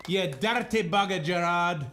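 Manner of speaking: put-on high-pitched, teasing Scottish accent. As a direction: tease the winner in a mock Scottish accent. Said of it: "why I became Scottish for a second".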